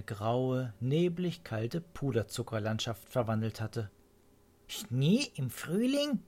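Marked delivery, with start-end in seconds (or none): 4.7-6.2 high, slow astonished bird call; astonished cry. A narrator's reading. Mrs Swallow sees spring snow, high astonished cry. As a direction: lift it up in disbelief. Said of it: "rief Frau Schwalbe".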